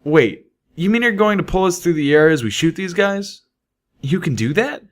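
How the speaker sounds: can't believe what she's hearing; incredulous Littlepip. A narrator's reading. Littlepip questions Calamity's plan.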